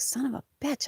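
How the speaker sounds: high, hushed mock whine; retold exasperation. Telling how she found the mess, exasperated. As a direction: groan the curse softly, high-pitched. Retold upset at cat accident.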